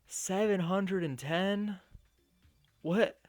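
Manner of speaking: high, loud, drawn-out disbelief; astonished. Real, not acted. Reader reacting to a huge auction bid, astonished.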